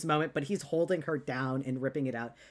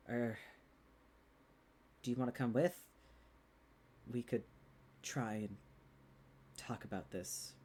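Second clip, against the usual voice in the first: soft invitation; 3 semitones lower, 6 dB quieter than usual. Acted. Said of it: "and he says".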